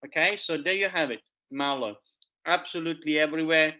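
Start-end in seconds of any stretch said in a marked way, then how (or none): none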